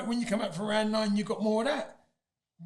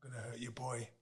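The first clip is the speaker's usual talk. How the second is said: much softer, lower threat; quiet menacing quote; 10 semitones lower, 15 dB quieter than usual. Retold or imitated. Boxer quoting the opponent's threat to his father. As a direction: murmur the threat coldly.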